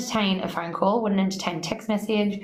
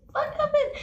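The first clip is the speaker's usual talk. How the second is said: sudden high startled "what happened"; a jolt of alarm at the crash, pitched way up; startled call. Retold or imitated. Hears a crash, calls out.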